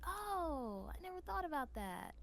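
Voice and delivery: mock casting director taken by surprise; imagined pleasant surprise. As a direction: react as if the idea is new to you. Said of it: "then it's like".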